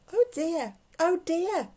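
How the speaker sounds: high-pitched fretting; anxious, high old-lady exclamation; worried old lady. A narrator's reading. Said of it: "said the little old lady".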